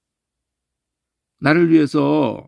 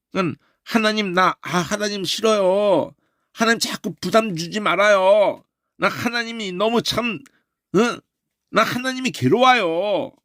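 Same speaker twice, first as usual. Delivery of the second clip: whining believer; 8 semitones higher than usual. Retold or imitated. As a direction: whine to God like a weary believer. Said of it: "괴로워서 이런 소리가 나와요". (하나님께 투정하는 높고 느린 징징거림)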